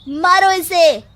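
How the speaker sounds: shouted order. Acted. Villagers turn on chief's daughter.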